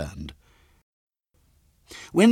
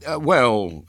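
a touch of reluctance, louder; hesitant; 4 semitones higher, 5 dB louder than usual. A narrator's reading. Father asked to pay the boys, hesitant. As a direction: stall with a drawn hesitation. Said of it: "hesitated Mister Bott".

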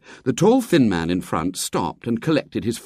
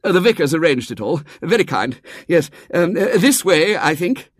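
high, loud, flustered dithering; harassed, anxious man; 11 semitones higher, 5 dB louder than usual. A narrator's reading. Tall man leading the children to tea, harassed. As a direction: herd the children along with stammers and fuss. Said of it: "He wore a harassed and anxious expression".

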